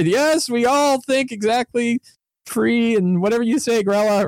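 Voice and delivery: high, droning chorus of followers; mock cultists agreeing as one; obedient cult followers. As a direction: chant it high like brainwashed villagers. Cult followers parroting their leader.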